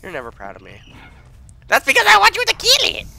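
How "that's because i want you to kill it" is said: very high, loud squawk of exasperation; exasperated yell. Real, not acted. Scolding partner who failed to kill it, exasperated yell. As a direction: shriek it in exasperation.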